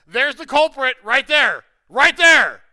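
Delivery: laughing accusation. Real, not acted.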